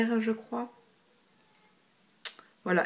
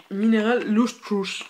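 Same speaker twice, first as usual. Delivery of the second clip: slow, louder French-tinged English accent; hammed-up English accent; 5 dB louder, slower than usual. Shown as a demonstration. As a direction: show off your English accent, drawn out. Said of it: "Avec mon super accent". (accent anglais exagéré, lent et appuyé)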